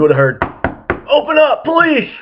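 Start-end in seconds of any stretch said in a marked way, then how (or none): 1.1-2.1 high, loud mock cop yelling at the door; mock police shout. Friend playing a cop pounding on the paranoid smoker's door, mock loud shout. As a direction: bang on the door like a cartoon cop.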